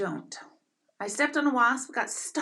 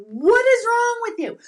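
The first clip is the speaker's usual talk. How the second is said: high, loud panicked yell, repeated; re-living the panic from the bathtub, shouting; retold scream; 12 semitones higher, 10 dB louder than usual. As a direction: shriek it at the husband holding the snake. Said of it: "I started screaming, going".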